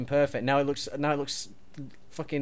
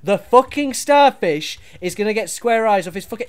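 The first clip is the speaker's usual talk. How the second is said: louder, higher, exasperated yelling; angry shout; 5 semitones higher, 10 dB louder than usual. Acted. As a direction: blow up at your partner over the build. Heated argument over the build.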